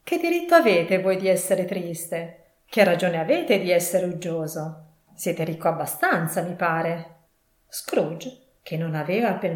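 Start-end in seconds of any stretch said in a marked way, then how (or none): none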